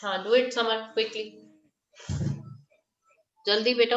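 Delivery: usual speaking voice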